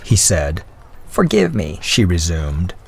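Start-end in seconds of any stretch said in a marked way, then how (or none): 1.1-1.8 put-on lightly raised voice of a gracious old lady; a hint of the old widow's voice; elderly lady voice. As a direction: speak as a kindly older woman. Mrs Callender apologises.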